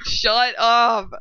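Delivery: stretched mock-annoyed retort; slow drawn-out shut up. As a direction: drag each word, fake exasperation.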